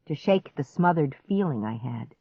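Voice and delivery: ordinary delivery, nothing marked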